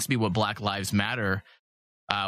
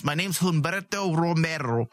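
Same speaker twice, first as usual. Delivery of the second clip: imitating Spanish accent on Humberto Romero; Spanish pronunciation; 3 semitones higher than usual. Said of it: "when he would introduce himself".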